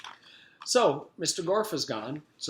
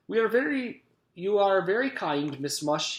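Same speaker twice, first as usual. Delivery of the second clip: high, loud, polite Scottish voice; pleasant Scottish gentleman; 7 semitones higher, 5 dB louder than usual. A narrator's reading. Mister Gorf thanks the cook, as pleasant Scotsman. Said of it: "pleasant voice he took from the Scottish gentleman".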